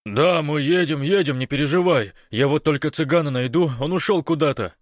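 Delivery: high, loud, agitated reply; flustered reassurance. A narrator's reading. Большой assures Гош they are coming, loud and flustered. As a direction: reassure loudly while in a panic.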